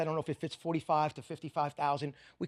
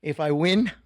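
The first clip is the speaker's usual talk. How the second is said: ordinary delivery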